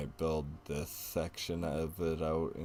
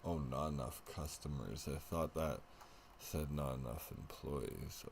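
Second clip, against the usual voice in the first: ordinary delivery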